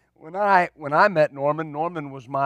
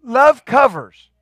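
preaching shout; 6 semitones higher, 9 dB louder, slower than usual. Real, not acted. Repeats the proclamation.

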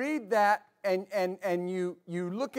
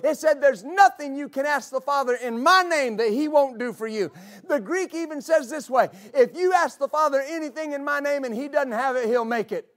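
raised, ringing preacher's voice; fiery preaching; 4 semitones higher, 4 dB louder than usual. Real, not acted. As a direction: preach it out with full force. Preaching at full force.

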